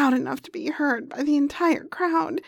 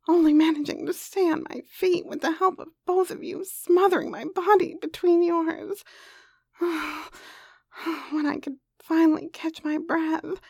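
ordinary delivery

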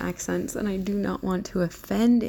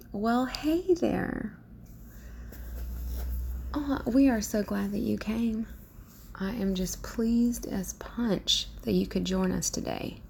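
Southern accent; 4 semitones higher than usual. Shown as a demonstration. Southern belle welcoming guests who have arrived, Southern accent. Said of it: "I can do a Southern accent".

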